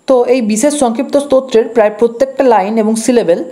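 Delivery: ordinary delivery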